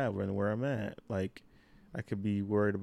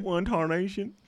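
a hint of a Southern country accent, pitched up; 8 semitones higher than usual. Friends teasing him, he slips into country accent. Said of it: "He just puts on a country accent sometimes".

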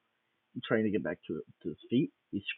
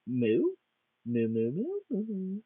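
put-on a girl raised by cows, mooing a question; 10 semitones higher than usual. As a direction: moo it like a curious question. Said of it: "and says".

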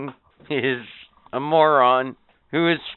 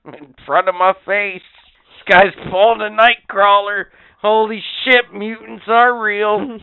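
hammed-up shocked wrestler, higher and louder; mock surprise; 6 semitones higher, 6 dB louder than usual. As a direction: ham up fake amazement. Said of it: "the guy in the ring is acting surprised".

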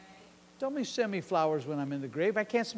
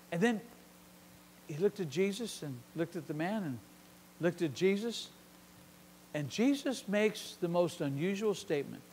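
ordinary talk, nothing marked

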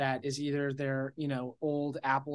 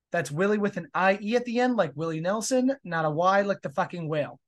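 upbeat, louder sign-off read; podcast outro; 4 semitones higher, 6 dB louder than usual. Acted. Host signing off the episode with an upbeat announcer read. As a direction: wrap up the show like a host.

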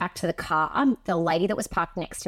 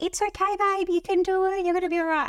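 much higher, coaxing and gentle; soothing mum voice; 12 semitones higher than usual. Pretend. Mum reassuring her daughter before the needle, high and coaxing. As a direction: sweet-talk a scared toddler, pitched way up.

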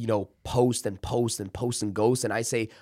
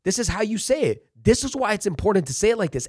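higher, upbeat coach voice giving orders; coaching a trainee; 7 semitones higher than usual. Retold or imitated. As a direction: hype the buddy up, tell him how it's done. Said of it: "I was telling people".

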